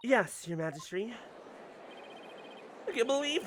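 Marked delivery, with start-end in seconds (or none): none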